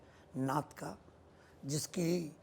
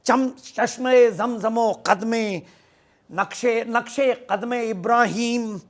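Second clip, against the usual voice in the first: loud, raised mushaira declamation; poetry recitation; 5 semitones higher, 6 dB louder, slower than usual. Acted. Poet recalls the old Hajj rites for his audience, ringing recitation. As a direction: declaim each phrase with long, ringing vowels.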